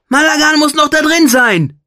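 high, loud alarmed call; hoarse cry. A narrator's reading. Fadon calls to Katsykos that Malagan is missing, hoarse cry. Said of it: "rief er heiser".

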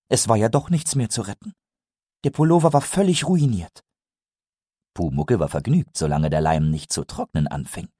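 ordinary delivery, nothing marked

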